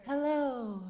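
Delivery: put-on light, slow, airy and childlike; air voice. Shown as a demonstration. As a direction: float it up lightly. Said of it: "What if we go to air?".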